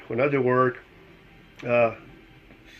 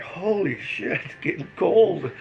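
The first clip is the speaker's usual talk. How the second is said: higher-pitched exclamation of dismay; retold surprise; 5 semitones higher, slower than usual. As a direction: recall being caught out by cold. Retells sudden cold on ride.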